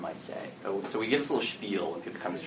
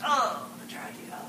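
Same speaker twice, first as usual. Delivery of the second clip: mock tragedy; 11 semitones higher, 4 dB quieter than usual. Player hamming up the reveal.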